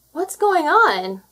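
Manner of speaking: put-on high, slow, drowsy young voice; sleepy seal, yawning. Seal waking upside down, yawning. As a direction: yawn it out as the seal wakes. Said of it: "he said with a yawn".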